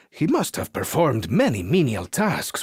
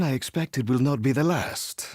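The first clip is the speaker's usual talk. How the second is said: normal talking voice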